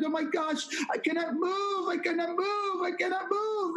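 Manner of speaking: high, insistent imitated exclamations; retold overwhelmed trembling. Trembling woman in the grocery store, retold as overwhelmed. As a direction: act out someone overpowered, pitched well up. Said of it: "She's trembling under the power of God".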